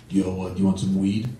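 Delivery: lightly voiced low-key dealer; street dealer. Stranger at a bus stop offers weed, low dealer voice. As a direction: offer it on the sly, pitched low.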